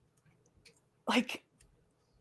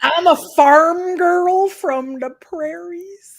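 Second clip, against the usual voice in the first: higher, slower, laughing through a hammed-up Southern twang; laughing folksy accent; 6 semitones higher, 7 dB louder, slower than usual. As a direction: play it up as a prairie farm girl, laughing. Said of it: "you say all the Southern things".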